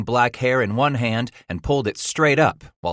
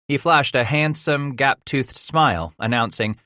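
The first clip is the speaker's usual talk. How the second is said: ordinary delivery, nothing marked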